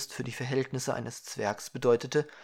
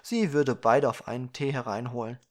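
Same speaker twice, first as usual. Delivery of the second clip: plain reading voice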